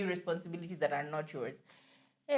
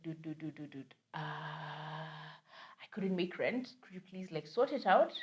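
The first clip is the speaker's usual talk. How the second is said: much softer, hushed begging; hushed pleading; 4 semitones lower, 6 dB quieter than usual. Retold or imitated. Tenant hiding at home begging roommate on phone, hushed plea. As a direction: plead quietly so nobody hears.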